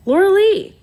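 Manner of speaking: high, loud, delighted shout of a name; excited high-pitched holler; calling out. A narrator's reading. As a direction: call out to a friend who has just arrived. Calling out to arriving friend.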